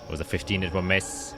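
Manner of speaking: ordinary talk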